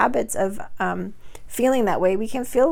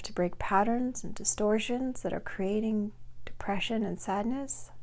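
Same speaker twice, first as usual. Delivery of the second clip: soft, unhurried meditation voice with long pauses; guided meditation; 9 dB quieter than usual. Acted. Coach guiding listeners to release depression, soft guided-meditation voice. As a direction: gently lead them through breathing and letting go, softer and measured.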